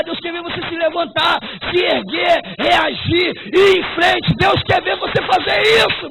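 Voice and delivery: loud, high, climbing shout to the church; full-force preaching. Acted. Pastor urging the church to rise, loud climbing preaching.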